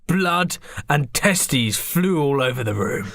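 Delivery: ordinary talk, nothing marked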